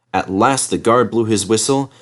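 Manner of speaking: plain reading voice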